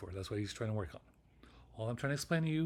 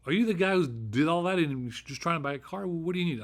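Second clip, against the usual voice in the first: loud, high, eager salesman greeting; eager car dealer; 5 semitones higher, 7 dB louder than usual. Retold or imitated. Imagined dealer eagerly greeting the famous worker, loud and high. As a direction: hail him like an excited dealer.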